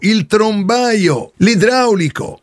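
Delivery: plumber character voice. Acted. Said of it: "io sono Caio, il trombaio".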